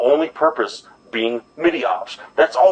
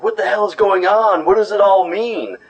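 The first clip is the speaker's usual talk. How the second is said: much higher, bewildered mock cry; baffled outcry; 11 semitones higher than usual. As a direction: throw your voice way up in total confusion. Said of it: "And everybody's like".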